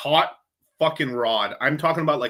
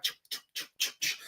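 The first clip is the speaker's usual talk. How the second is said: imitated cutting sound; 10 dB quieter than usual. Grandma gutting the pig.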